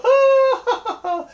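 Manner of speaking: high, loud burst of laughter. Acted. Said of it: "Brer Rabbit laughed some more".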